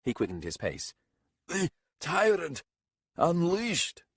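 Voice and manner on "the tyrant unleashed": high, gasping outcry; gasped exclamation. A narrator's reading. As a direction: gasp it out while trailing behind. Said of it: "gasped Garalko somewhere behind him".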